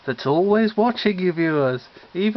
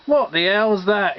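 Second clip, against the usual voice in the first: cried out; 3 semitones higher, 4 dB louder than usual. Acted. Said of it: "he cried".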